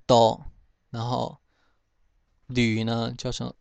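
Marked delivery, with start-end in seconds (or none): 0.1-0.5 多 as "tōo", open o; Hokkien reading. Shown as a demonstration. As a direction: say 多 as Hokkien "too". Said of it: "应该是念成多".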